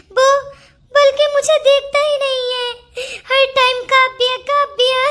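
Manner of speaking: tearful complaint. Acted. Jealous wife complains, crying.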